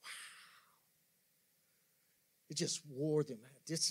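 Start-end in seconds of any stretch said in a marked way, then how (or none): 0.0-0.8 barely whispered, weary awe; breath-only reaction, very soft; hushed wow. Acted. Reacting to the verse's hardship.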